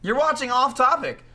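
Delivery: high-pitched, peppy show intro. Acted. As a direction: pitch it up high like a hype greeting.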